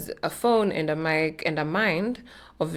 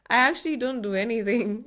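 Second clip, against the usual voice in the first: higher-pitched retold reply through a laugh; amused, laughing; 6 semitones higher than usual. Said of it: "And I laughed and I'm like".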